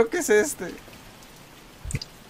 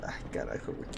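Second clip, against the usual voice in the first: scared whisper; 17 dB quieter than usual. Real, not acted. Whispered in fear.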